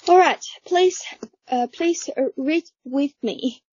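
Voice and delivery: normal talking voice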